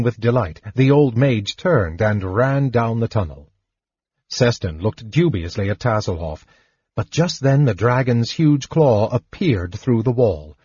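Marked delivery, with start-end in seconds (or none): none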